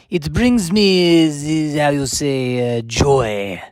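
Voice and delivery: put-on slow, drawn-out hammy French accent; bad French accent. Comedian showing off his beloved terrible accent, bad French accent. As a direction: ham up a terrible French accent, stretching the words. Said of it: "talking in a bad French accent".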